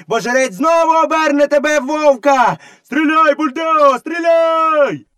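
high-pitched mob yell, far above narration; villagers shouting. A narrator's reading. Villagers driving the boy away, shouting. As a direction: yell curses like an angry crowd. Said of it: "селяни закричали".

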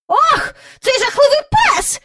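very high, loud fussy lady voice; disgusted lady. A narrator's reading. Miss Lark seeing Andrew's scruffy companion, high disgust. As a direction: recoil at the dog. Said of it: "промовила міс Джайворон".

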